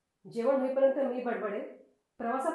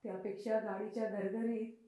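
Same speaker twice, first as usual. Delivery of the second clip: weary, drowsy; 3 semitones lower, 7 dB quieter than usual. Acted. Woman recalls tiring of questions and dozing, drowsy. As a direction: let it fade, slowing into a drowsy hush.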